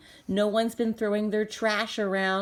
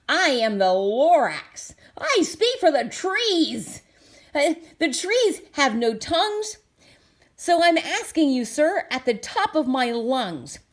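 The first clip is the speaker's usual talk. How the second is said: higher, loud and bossy little creature; sharpish, bossy Lorax; 5 semitones higher, 4 dB louder than usual. Acted. Lorax confronts the Once-ler, bossy sharp scolding. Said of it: "spoke with a voice that was sharpish and bossy".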